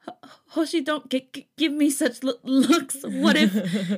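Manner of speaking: flustered stammering, high and breathless; stuttering. Acted. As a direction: stammer it out shyly. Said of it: "I didn't know why it was stuttering".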